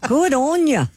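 high, bright Aussie "Good on ya"; Australian accent. Shown as a demonstration. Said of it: "do 'em in an Australian accent".

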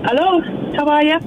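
hint of an Irish accent. Real, not acted. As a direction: greet them with a slight Irish accent. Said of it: "Jer, you've got an accent now".

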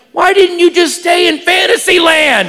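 high, pleading yell of protest; high-pitched mock-anguished shout; exasperated shout. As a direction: wail it at her in exasperation. Mock protest at her grim story.

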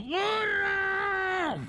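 very high, long-drawn call; squeaky mock scream for help; shouting ant. Ant in the swamp calls the donkey, high shout. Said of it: "Y gritó".

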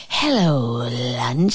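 low, slow, drawn-out snake voice; sinister snake. A narrator's reading. Snake greeting Frog and Toad as lunch, sinister.

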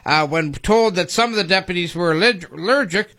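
usual speaking voice

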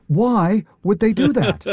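high, slow mock-incredulous question; David Childress. Guest joins host in imitating the researcher, high slow impression. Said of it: "He would say".

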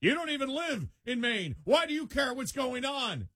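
high mocking whine of a national pundit; high scornful pundit mimicry; mocking quote. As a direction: sneer it in a high put-on voice. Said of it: "somebody national saying".

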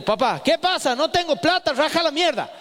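voz bastante más aguda de padre gritón; imitá al padre que echa al hijo de mal modo; padre bruto. Retold or imitated. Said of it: "Me le acerco y yo le digo". (el padre en el almacén le habla al hijo a los gritos, agudo)

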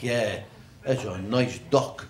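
deadpan. Retold or imitated. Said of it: "I'm like".

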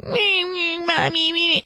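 high, mock-whiny child voice; whiny crying boy. Host imitating a crying boy at Taekwondo, high and whiny. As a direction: whimper like a spoiled little boy. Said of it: "he was like".

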